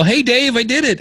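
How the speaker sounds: high, excited voice for a triumphant email; retold excitement. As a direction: act out someone proudly announcing success.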